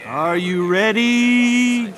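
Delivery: ordinary talk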